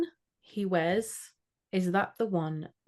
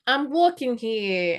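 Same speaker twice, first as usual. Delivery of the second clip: put-on big, drawn-out New York accent; Scottish New Yorker; 5 semitones higher, 4 dB louder, slower than usual. Host trying the witch as a New Yorker, hammed-up New York accent. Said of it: "You need to be like a Scottish New Yorker".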